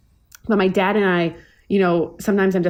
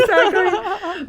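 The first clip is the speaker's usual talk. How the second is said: laughing; 13 semitones higher, much slower than usual. Real, not acted. Laughing with friends.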